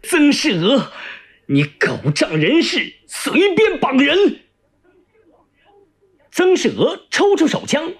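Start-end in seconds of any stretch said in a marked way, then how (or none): 0.0-4.4 furious scolding, leaping high and drawn out at the end; hopping-mad cursing. Acted. Tied-up prisoner cursing his captor, hopping mad. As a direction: rail at them in outrage. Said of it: "蹦着脚叫骂".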